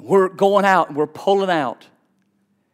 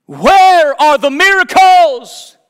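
high, loud and drawn out slowly; preaching cry; 11 semitones higher, 8 dB louder, much slower than usual. Acted. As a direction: thunder the question at the congregation. Preacher's climax to the congregation.

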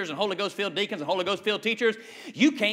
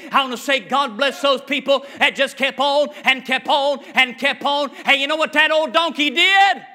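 driving, raised revival cadence building louder; full-force preaching; 3 semitones higher, 7 dB louder than usual. Acted. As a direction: hammer out each phrase to a loud peak.

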